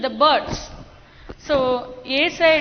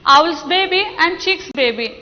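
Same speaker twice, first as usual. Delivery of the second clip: ordinary delivery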